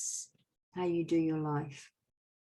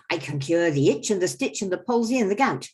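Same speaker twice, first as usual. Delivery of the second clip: louder, higher boastful quack rhyme; the quack doctor boasting; 3 semitones higher, 8 dB louder than usual. Acted. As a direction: sell your cures like a fairground quack. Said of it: "So I go".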